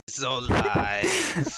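very slow mock denial; drawn-out protest. Contestant denying the Kerbo shooting, drawn-out mock protest. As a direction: stretch LIES out long.

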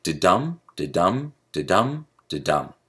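"da-dum" four times, each light da then stressed dum; iambic beat. Shown as a demonstration. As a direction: chant "da-dum" in even pairs, weight on dum. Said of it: "It sounds a lot like".

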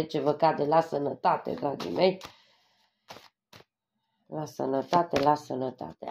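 half to herself, reading the cards under her breath; murmured to herself. Real, not acted. Tarot reader murmurs to herself over the health cards, softly.

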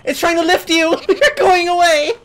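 high laughing excitement. Real, not acted. Player carried away, laughing.

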